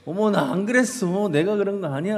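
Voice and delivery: put-on high, indignant child's denial; protesting boy. Boy protesting to his mother that he didn't do it. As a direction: squeal it like a wronged little boy. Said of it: "아들이 펄펄 뛰는 거예요".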